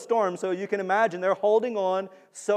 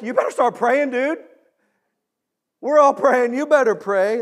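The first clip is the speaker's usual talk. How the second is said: louder, higher, pressing order; urgent captain; 7 semitones higher, 9 dB louder than usual. Retold or imitated. Ship's captain to sleeping Jonah, loud and urgent. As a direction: yell it over the storm at him.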